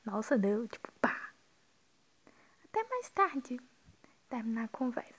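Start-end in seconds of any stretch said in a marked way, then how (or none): 2.6-3.6 "Até mais tarde" said much higher, falling on "mais"; sing-song high goodbye; high pitched farewell. Shown as a demonstration.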